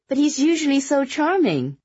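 high, loud disbelief; surprised protest. A narrator's reading. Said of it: "furrowed her brow in surprise".

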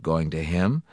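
plain reading voice

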